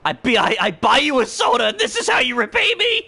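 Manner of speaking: hammed-up betrayal shout; mock outrage. Yelling at the game character who took his soda, hammed-up outrage.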